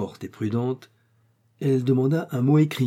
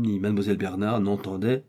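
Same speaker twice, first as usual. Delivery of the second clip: ordinary delivery, nothing marked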